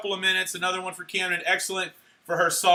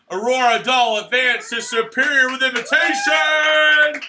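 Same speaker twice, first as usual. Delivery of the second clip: raised, loud award announcement; excited announcer; 5 semitones higher, 6 dB louder than usual. Real, not acted. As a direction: build to a stretched final word.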